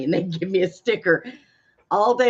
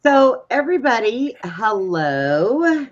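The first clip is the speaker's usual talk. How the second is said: higher, louder on-air welcome; host greeting; 6 semitones higher, 5 dB louder, slower than usual. Acted. Host turning to the audience to start the show: bright announcer hello. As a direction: greet the listeners like a radio host.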